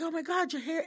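high-pitched imitation of a delighted admirer; happy gushing. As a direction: gush like a happy frat boy.

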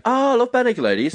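high-pitched gushing fan; imitated excitement. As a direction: gush like a delighted friend. Friend's keen reaction, acted out.

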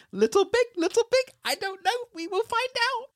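very high, cute sing-song voice; cute girlish voice. Retold or imitated. Girlfriend teasing the guy in a high, cute voice. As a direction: squeak it out like a cutesy girlfriend. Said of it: "And she's like".

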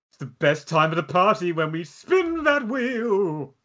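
slow, grand announcer build-up; game show announcer. Acted. Announcer-style show segment read.

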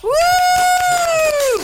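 loud high whoop; cheering. Real, not acted. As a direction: whoop with excitement. Cheering at podcast start.

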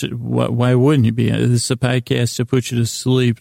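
ordinary talk

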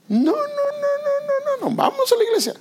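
voz muy aguda y lenta, medio llorosa; súplica teatral de padre desesperado; padre insistente exagerado. Retold or imitated. Padre obliga al hijo a ir. (padre imitado con voz chillona y llorosa)

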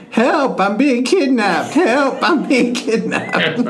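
high, slow, laughing yell for help; playful fake kidnapping scream; mock screaming with laughter. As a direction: yell it out the window, half laughing. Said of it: "starts screaming".

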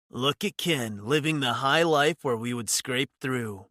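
a hint of a resentful brother; lightly voiced bitter sibling; sibling's snappish jab. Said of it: "Hiro would snap".

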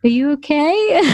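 high, tentative "are you okay"; imitating worried husband. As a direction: mimic him creeping up, unsure. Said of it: "Alan was like".

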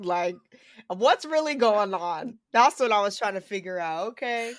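ordinary delivery

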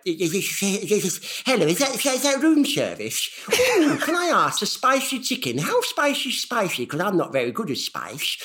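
put-on high, wavering lonely old man on the phone; old man Derek voice. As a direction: play a timid elderly man ordering room service. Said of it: "he goes a little bit like this".

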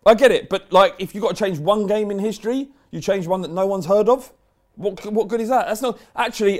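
ranting. Real, not acted. Heated rant at listener.

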